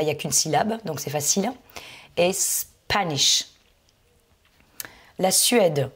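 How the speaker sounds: ordinary talk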